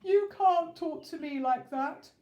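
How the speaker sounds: the king to Miss Sunshine, sobbing. Acted. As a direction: sob it out indignantly. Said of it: "sobbed the king".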